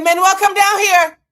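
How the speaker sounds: loud, high-pitched yell downstairs; exasperated holler; calling out. Retold or imitated. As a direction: summon him from another floor at full volume. Story, calling Manuel downstairs.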